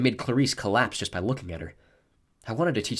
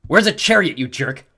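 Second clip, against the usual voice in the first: loud angry demand; girl confronting the bully who stole her chariot; 7 semitones higher, 10 dB louder than usual. A narrator's reading. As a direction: spit it out, fists balled. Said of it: "Clarice balled her fists".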